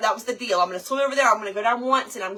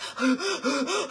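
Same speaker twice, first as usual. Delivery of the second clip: gasping for air; exhausted gasp; 5 semitones higher than usual. Retold or imitated. Mom gasping after a long swim. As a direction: suck in a ragged breath. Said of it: "I'm like".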